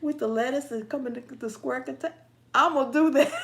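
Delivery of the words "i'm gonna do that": high, loud, about to burst; gleeful resolve. Real, not acted. Host vowing to fool him, loud with glee. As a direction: blurt it out just before cracking up. Vows to pull the prank.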